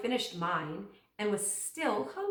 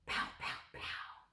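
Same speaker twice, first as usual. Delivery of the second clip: put-on breathy little-boy whisper of pow pow; soft unvoiced pow pow pow; 11 dB quieter, slower than usual. A narrator's reading. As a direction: whisper the pows like a kid playing guns. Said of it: "Then I heard Fudge whisper".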